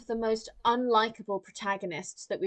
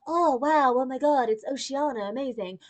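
a hint of gushing doll awe, fading to flat; mock Barbie gasping at the ocean world; mock Barbie wonder. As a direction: play up fake amazement, then let it die. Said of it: "she's like".

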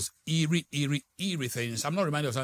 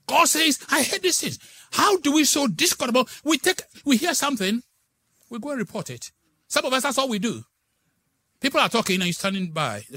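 preaching at full force; 10 semitones higher, 7 dB louder than usual. Acted.

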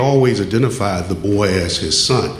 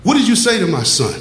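much higher, fast angry outburst; retold furious yelling; 9 semitones higher than usual. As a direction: hurl it at him, pitch up. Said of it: "started screaming and cussing at Candy".

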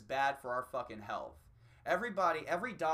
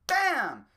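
gunshot; 9 semitones higher, 12 dB louder than usual. Retold or imitated. Mimicking the shot that killed [name], loud gunshot. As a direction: shout a gunshot sound. Gunshot after naming assassinations.

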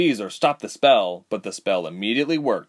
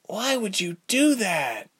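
put-on young heroine; 12 semitones higher, slower than usual. Ilse voiced higher.

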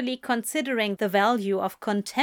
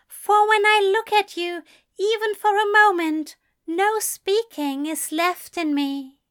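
much higher and louder, rapturous; swelling recital; 11 semitones higher, 5 dB louder than usual. A narrator's reading. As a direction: let it soar with feeling.

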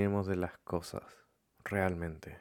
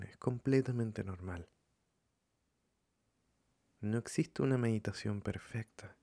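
calm, softened meditation voice with long pauses; guided meditation; 4 dB quieter than usual. Acted. Leading listeners to follow their breathing, calm meditation voice. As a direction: guide them gently, leaving room between phrases.

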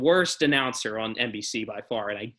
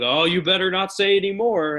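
mock warning; 7 semitones higher, 6 dB louder than usual. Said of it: "they were like".

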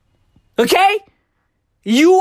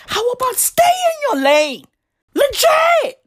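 higher, shouted put-down; scolding yell; 8 semitones higher than usual. Real, not acted. Telling Herbalife girls to stay in their lane, shouted. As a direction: snap them back in line.

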